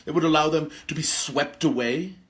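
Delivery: usual speaking voice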